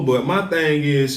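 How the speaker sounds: ordinary talk, nothing marked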